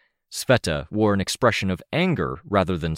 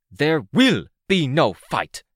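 pissed command; 11 semitones higher, 4 dB louder than usual. A narrator's reading. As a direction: lay down the law to the whole crowd. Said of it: "She sounded pissed".

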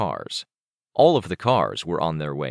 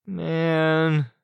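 high, drawn-out groan; 11 semitones higher than usual. A narrator's reading. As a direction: stretch it into a kid's groan. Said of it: "Chicken Little groaned".

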